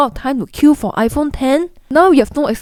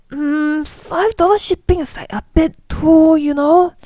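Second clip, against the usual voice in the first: higher, doubtful hemming shopper; mock reluctant buyer, pitched up; hesitant shopper; 6 semitones higher than usual. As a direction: hum and haw over the shipping fee in a higher voice. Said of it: "They'll be like".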